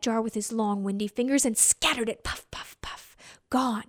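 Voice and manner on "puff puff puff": soft unvoiced puffs, fading; whispered puff puff puff. A narrator's reading. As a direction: blow each puff away softly. Wind scattering grain.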